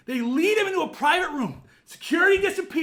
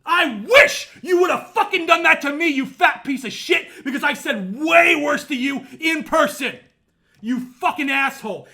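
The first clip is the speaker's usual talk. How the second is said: ordinary talk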